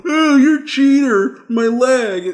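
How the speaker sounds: put-on high, loud, drawn-out kid voice; mock a kid wailing into the microphone; whining kid. Imitating a kid on game voice chat, whining. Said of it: "you hear some kid telling".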